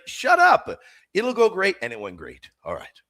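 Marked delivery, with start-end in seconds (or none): 0.1-1.8 high, loud mock-exasperated retort; exasperated reassurance. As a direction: act out someone brushing off a worrier. Retold brushing off, repeated.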